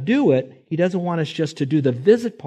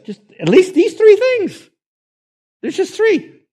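much higher and louder, slow and insistent; emphatic preaching; 11 dB louder than usual. Real, not acted. Preacher urging the congregation, emphatic and loud. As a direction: hammer home the three points. Preacher driving home point.

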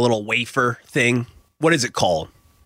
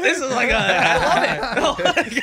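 excited; 9 semitones higher than usual. Real, not acted. Gushing about pigs in blanket.

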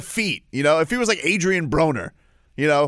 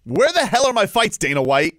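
high, raised-voice challenge; indignant demand; 4 semitones higher, 3 dB louder than usual. Real, not acted. Demanding answers from the fight promoter, indignant demand. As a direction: call him out, voice pitched up.

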